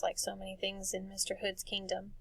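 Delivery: ordinary delivery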